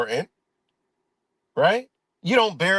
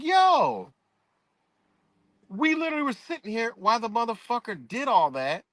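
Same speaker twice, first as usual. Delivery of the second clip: much higher, stunned realization that it happened right beside him; sudden realization; 8 semitones higher than usual. Retold or imitated. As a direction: blurt it out as the truth dawns on you. Realizing they were right there.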